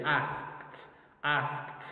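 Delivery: northern British "asked" with the a of cat; northern British accent. Shown as a demonstration. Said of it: "more to the north, we can say".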